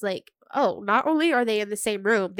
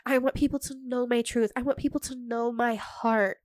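lightly voiced gushing celebrity quote; hint of a heartfelt star confession; mock celebrity sincerity. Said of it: "key celebrity phrases are like".

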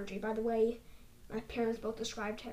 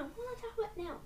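squeaky high repeat; high silly voice; 10 semitones higher, 7 dB quieter, faster than usual. Acted. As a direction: pipe it up high and soft.

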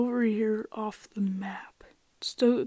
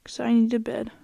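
usual speaking voice

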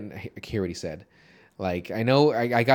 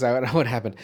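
normal talking voice

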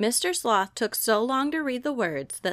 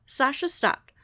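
ordinary delivery

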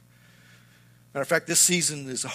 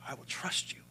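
choked up; 11 dB quieter than usual. Real, not acted. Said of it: "every time I say it today, it still messes me up".

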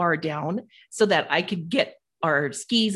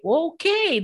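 excited okay; 11 semitones higher than usual. Retold or imitated. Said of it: "Now then I was excited".

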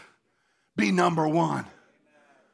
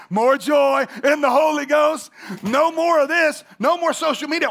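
preaching shout; 6 semitones higher, 6 dB louder than usual. Acted. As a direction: proclaim it to the whole church. Preaching crescendo on the Lord's words.

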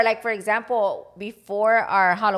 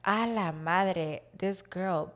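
stunned mutter; 3 semitones lower, 8 dB quieter, much slower than usual. Retold or imitated. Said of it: "when she said it like that".

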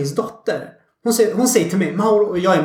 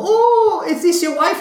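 much higher, laughing mock surprise; surprised friend; 12 semitones higher, 3 dB louder, slower than usual. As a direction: squeal it out like a giggling friend. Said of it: "hon bara". (förvånad väninna, mycket ljusare och skrattande)

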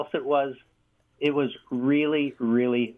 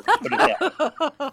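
amused; 14 semitones higher, 4 dB louder than usual. Real, not acted. Joke punchline, laughing.

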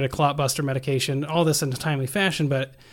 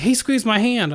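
higher-pitched imitation of an excited wife; retold excited claim; 7 semitones higher, 3 dB louder than usual. Wife telling the nurse her husband responded, mildly excited. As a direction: report it like a hopeful wife, pitched up.

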